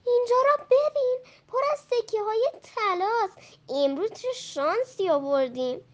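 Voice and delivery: high, excited cry of discovery; thrilled to find the gold; joyful. Acted. Merchant shows his friend the gold, delighted. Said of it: "با خوشحالی گفت".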